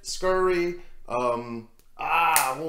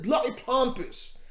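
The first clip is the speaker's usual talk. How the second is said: lightly put-on British accent on "Bloody pompous"; a hint of posh British scorn; mock British accent; 6 semitones higher, slower than usual. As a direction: say it like a sniffy Brit. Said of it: "that's how I said it".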